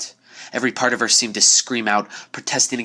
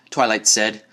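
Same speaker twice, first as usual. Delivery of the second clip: ordinary delivery, nothing marked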